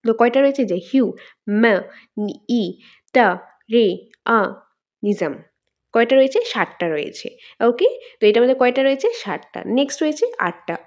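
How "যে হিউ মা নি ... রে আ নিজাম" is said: each syllable said separately with a clear pause between. Shown as a demonstration. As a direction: break the long word into isolated beats. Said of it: "ভাগ করলে কয়টা হয়েছে".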